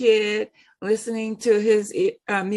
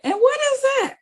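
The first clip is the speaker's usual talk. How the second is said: bewildered teller. Retold or imitated. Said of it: "she said".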